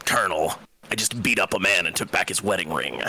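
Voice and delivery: low, gruff soldier voice; Solid Snake impression. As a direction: do Solid Snake, deep and serious. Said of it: "decent Solid Snake impression".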